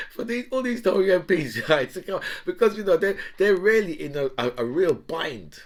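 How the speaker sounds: laughing, high and loud; amused laughing. Real, not acted. Comedian cracking up over the Tory MPs' bind, laughing.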